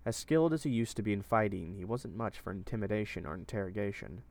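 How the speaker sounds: ordinary delivery, nothing marked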